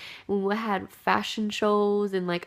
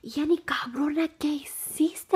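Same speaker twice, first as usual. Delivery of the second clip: higher, whispered scolding from mom; panicked parent whisper; 7 semitones higher than usual. Retold or imitated. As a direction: hiss it like a frightened mom. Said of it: "they were just like".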